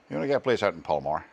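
quick imitation of a Northern Irish speaker; Northern Irish accent. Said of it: "from a guy that was from Northern Ireland".

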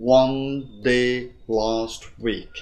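slow, each word stretched; slow four-beat model reading; slightly drawn out. Shown as a demonstration. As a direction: hold each of the four words. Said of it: "Hơi kéo dài một tí".

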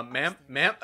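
usual speaking voice

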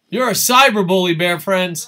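loud, teasing call-out; loud mock accusation. Real, not acted. Streamer accusing a chat friend of bullying, loud mock scolding.

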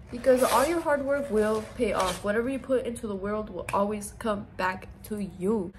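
ordinary talk, nothing marked

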